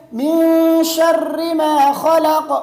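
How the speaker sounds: "شر" ending in short i, slowly chanted; correct tajweed. Shown as a demonstration. Teacher models the next verse, correct tajweed. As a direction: recite "من شر ما خلق" slowly with long held nasal and vowels. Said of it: "آگے کیا ہے".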